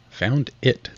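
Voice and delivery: rather cross. A narrator's reading. Mouse answering the interrupting duck, rather cross. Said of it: "replied rather crossly".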